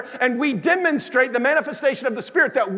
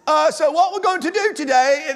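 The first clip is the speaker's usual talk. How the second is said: higher-pitched, hammed-up British accent; bad British accent; 5 semitones higher, 3 dB louder than usual. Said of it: "I'll throw out a British accent".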